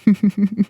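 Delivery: short closed-mouth chuckle; villainous chuckle. Acted. Vampire amused by the captive's question, short smug chuckle.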